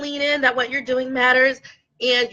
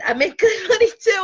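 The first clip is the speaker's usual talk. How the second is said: laughing through tears; 8 semitones higher than usual. Real, not acted.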